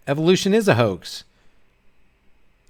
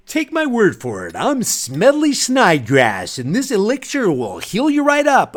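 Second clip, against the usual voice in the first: hammed-up, drawn-out elixir pitch; snake oil salesman; 3 semitones higher than usual. Snake oil salesman pitching a miracle elixir, hammed-up pitch. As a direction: sell it like a travelling quack. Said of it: "based on snake oil salesman".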